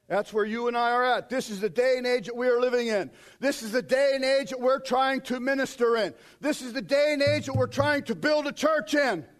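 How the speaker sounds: ordinary delivery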